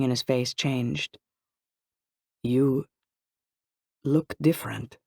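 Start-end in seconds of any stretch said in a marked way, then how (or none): 2.4-5.0 slow, halting observation; said slowly. A narrator's reading. Anthony noticing a change in Targa, slowly. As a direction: pause between the words, drawing them out. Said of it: "he said slowly".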